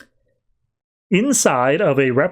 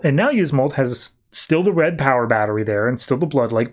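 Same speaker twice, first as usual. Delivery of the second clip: ordinary talk, nothing marked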